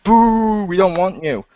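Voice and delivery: mock jeer, starting high and loud; imitated crowd booing. Podcaster mimics fickle crowd rejecting her, loud mock boo. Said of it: "they're like".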